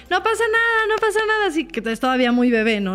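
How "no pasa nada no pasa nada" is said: much higher, singsong grown-up reassurance; everyone soothing a child. Retold or imitated. Adults to a fallen kid, high singsong reassurance. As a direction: chirp it like every parent does. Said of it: "Se caía y".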